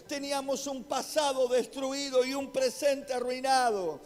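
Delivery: high, emphatic preaching voice; forceful preaching. Real, not acted. Pastor tells the church of God's great works, forceful preaching. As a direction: proclaim it to the whole congregation.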